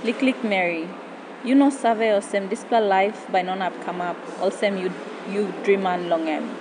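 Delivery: spoken in Tok Pisin; papua new guinea pidgin. Acted. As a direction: recite in PNG pidgin. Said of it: "poem switches into Tok Pisin".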